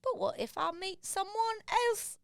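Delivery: Cockney. Acted. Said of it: "she's Cockney now".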